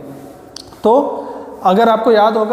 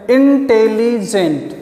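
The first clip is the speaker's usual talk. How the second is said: Intelligent slowly divided into syllables; much slower than usual. Shown as a demonstration. Teacher showing students syllables, intelligent broken up slowly. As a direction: say intelligent in slow, separate chunks. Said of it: "तो हमने कितनी बार में बोला".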